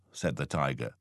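usual speaking voice

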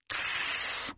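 sharp angry hiss through the teeth; fierce hiss. A narrator's reading. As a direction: hiss to silence the child. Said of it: "She hissed so fiercely".

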